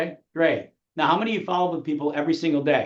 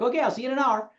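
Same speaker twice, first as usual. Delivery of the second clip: higher-pitched mock agent; lightly acted, pitched-up phone sign-off; mock casual sign-off; 8 semitones higher, faster than usual. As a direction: imitate an agent breezily confirming. Mimics agent's chirpy Saturday call.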